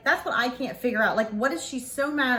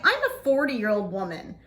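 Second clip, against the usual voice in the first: ordinary delivery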